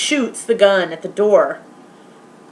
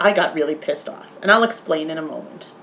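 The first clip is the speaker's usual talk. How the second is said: normal talking voice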